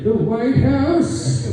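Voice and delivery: put-on confused old man. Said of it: "And they're like".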